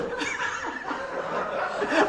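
breathless airy laughter. Real, not acted. At the clerk's joke, breathless laughter. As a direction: wheeze out a silent laugh.

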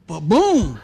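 punchy loud ba-boom. Retold or imitated. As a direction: hit it hard like the crash. Acting out head hitting wheel.

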